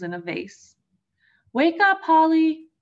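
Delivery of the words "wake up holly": calling loudly across the house. Acted. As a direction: call him down to breakfast, loud and high. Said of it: "her father called".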